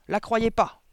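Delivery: loud, high interruption; cutting in. A narrator's reading. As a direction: cut her off abruptly. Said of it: "coupa madame Verdebois".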